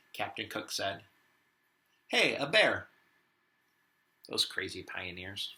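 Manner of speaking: lively picture-book read-aloud as Warren the wombat; reading to children. Acted. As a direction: read it to the class with a touch of Aussie flair. Teacher reads picture book aloud.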